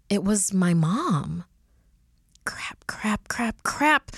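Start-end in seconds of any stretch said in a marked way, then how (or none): 2.4-4.1 rising panic. A narrator's reading. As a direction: start under the breath, then climb sharply in alarm. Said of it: "I started panicking".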